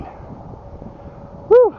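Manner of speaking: sharp high puffing exhale after the climb; breathless whew. Real, not acted. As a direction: blow out air, winded from walking up. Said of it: "I'm out of breath".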